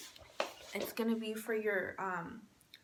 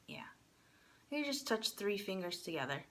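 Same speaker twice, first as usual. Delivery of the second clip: ordinary delivery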